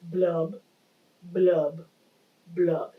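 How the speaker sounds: low, evenly spaced blubs; dull, low bubbling words; pout fish blubbing. Shown as a demonstration. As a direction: demonstrate the glum fish's blubs, one by one. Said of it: "you'll have the pout, pout fish doing this".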